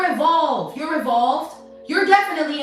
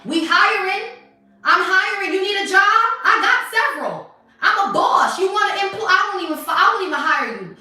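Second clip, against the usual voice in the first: mocking taunt; 5 semitones higher, 4 dB louder than usual. Real, not acted. Mocking offer of a job.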